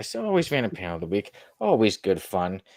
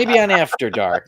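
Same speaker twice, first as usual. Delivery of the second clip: laughing; 7 semitones higher, 8 dB louder than usual. Real, not acted. Joke said twice, first laughing.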